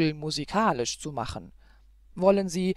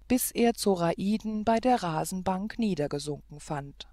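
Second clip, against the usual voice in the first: usual speaking voice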